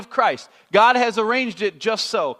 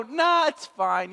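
casual shrug; 6 semitones higher, much slower than usual. Retold or imitated. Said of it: "And I go".

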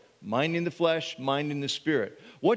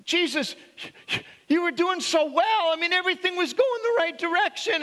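much higher, fast reproachful pleading; Peter scolding Jesus; 12 semitones higher than usual. Retold or imitated. Peter rebuking Jesus, high and reproachful. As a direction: scold him like a let-down friend. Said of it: "He actually says".

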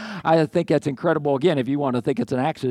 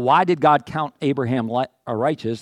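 ordinary talk

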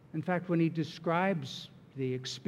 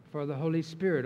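normal talking voice

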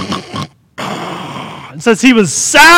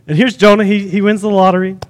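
ordinary talk

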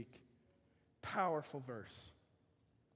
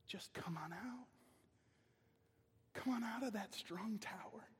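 soft, hushed coaxing; serpent's whisper of a lie; whispered temptation; 4 semitones higher, 5 dB quieter than usual. Acted. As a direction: lure them out in a whisper.

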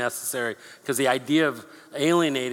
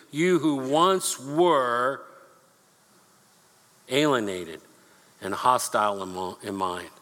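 dramatic slow reading; 3 semitones lower than usual. Acted.

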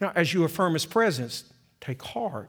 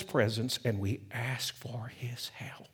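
whisper; 6 semitones lower, 9 dB quieter, slower than usual. Real, not acted. Whispered summary of sermon point.

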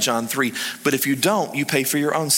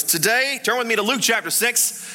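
mock preacher proclamation, pitched well up; imitating a preacher; 7 semitones higher than usual. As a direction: announce the sermon topic like an eager pastor. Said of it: "he opens the Bible and he goes".

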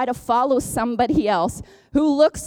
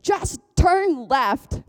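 slow, higher-pitched mock announcement; super-clear instruction; 6 semitones higher, much slower than usual. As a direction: spell out the direction very slowly, as a guiding voice. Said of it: "seasons in my life where it's like".

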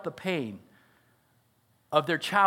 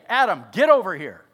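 loud stern summons; dad yelling; 8 dB louder than usual. Retold or imitated. Preacher shouting as a stern father calling his son. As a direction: bark it like an angry dad. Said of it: "But he doesn't shout".

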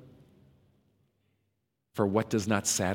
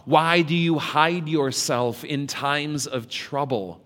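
loud, pleading lament of unanswered questions; impassioned preaching; 4 semitones higher, 5 dB louder than usual. Acted. Preacher to congregation, voicing a loud lament. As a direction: cry the whys out to God. Opening lament read with force.

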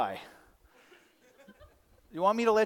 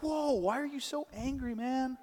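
high, protesting mock alarm; mock taken aback; 8 semitones higher, slower than usual. Person grabbed by God, in startled protest. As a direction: protest in a lightly hammed-up high voice.

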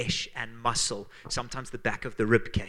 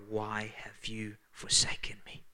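slow, breathed almost silently; hushed whisper; 5 dB quieter, slower than usual. Acted. Pastor reads Jesus's cry from the cross, whispered slowly. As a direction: let the cry fade to a whisper.

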